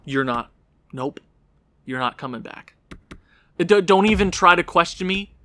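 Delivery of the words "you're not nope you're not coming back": softer, final verdict; quiet. Real, not acted. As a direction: say it softly, done with him.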